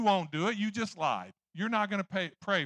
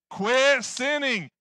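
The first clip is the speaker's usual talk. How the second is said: much louder, higher and slow, stretched out; preaching shout; 6 semitones higher, 9 dB louder, much slower than usual. Real, not acted. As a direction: hammer it home from the pulpit, slow and loud. Preacher exhorting the congregation.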